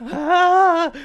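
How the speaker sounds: very high, loud drawn-out squeal; excited squeal. Acted. Game master voicing a character who gets really excited, squealing. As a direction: squeal at full volume with excitement. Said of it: "gets really excited".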